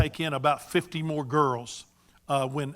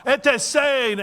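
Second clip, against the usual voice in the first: high, loud called-out greeting; hollering; 9 semitones higher, 6 dB louder than usual. Retold or imitated. Greeting a Ghanaian acquaintance across the store, hollered. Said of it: "I would holler out in a store".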